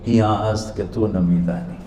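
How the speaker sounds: soft, quiet and guileless reply; quiet innocent dervish; innocently. Retold or imitated. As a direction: answer with gentle innocence. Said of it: "بڑی معصومیت سے بولے".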